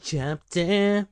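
odd, high chapter announcement; put-on announcer. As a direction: repeat each word like an echo.

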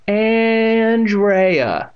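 ANDREA stretched long, high and loud; mock cultured voice. A narrator's reading. Girl mocks her hated name, mock cultured ANDREA. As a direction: draw out ANDREA with snooty grandeur. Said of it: "stretched her name out in a deep, cultured voice".

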